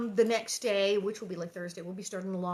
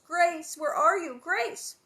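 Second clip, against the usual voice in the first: louder, higher calling; distant calling; 7 semitones higher, 7 dB louder, slower than usual. A narrator's reading. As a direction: call into the slough. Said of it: "Pa shouts and Ma's".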